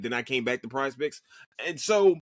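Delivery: normal talking voice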